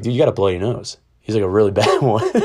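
laughing through the retold line; amused retelling. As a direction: quote dad with a laugh breaking in. Retells dad laughing at nosebleed.

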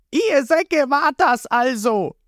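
high, slow angry yell; shouting back. A narrator's reading. Ruffy yells back at the villains, high angry shout. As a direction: hurl the accusation at them. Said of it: "schreit zurück".

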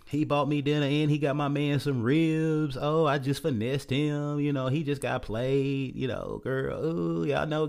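smug girl bragging she played him; hammed-up boastful girlfriend voice; bragging woman. Woman bragging on social media.

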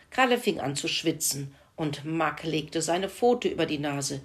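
usual speaking voice

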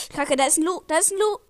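startled gamer shouting a warning; alarmed. Real, not acted. Enemy suddenly appears in game.

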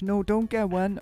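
high, mocking imitation; mock advice. Imitating the friend telling him not to get a cover, high mocking.

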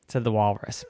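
usual speaking voice

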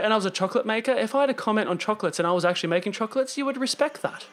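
hammed-up old newsreel announcer; lightly voiced vintage radio narrator; 1930s narrator voice. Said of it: "going back to nineteen thirties voice".

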